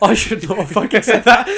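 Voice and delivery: high, loud, through laughter; hammed-up oh-no realisation; mock panicked regret. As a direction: blurt the regret, half laughing. Acted regret after insult, laughing.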